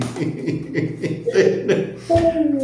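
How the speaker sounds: high laugh. Real, not acted. Laughs after cuckoo demo.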